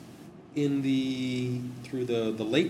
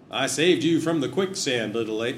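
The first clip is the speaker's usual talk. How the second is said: mock American cowboy; 3 semitones higher, 7 dB louder than usual. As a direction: play the rescuing American hero, hammed up. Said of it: "that's American, isn't it?".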